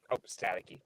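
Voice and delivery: ordinary talk, nothing marked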